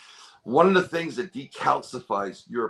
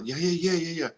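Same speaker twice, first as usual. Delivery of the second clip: higher, rapid-fire retold yeses; eager agreement; 6 semitones higher, faster than usual. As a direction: imitate someone confirming it with growing certainty. Retold wife eagerly confirming description.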